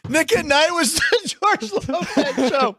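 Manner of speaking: words broken up by real laughter; laughing. Real, not acted. Host laughing at the cohost's George Lopez joke, laughing through it.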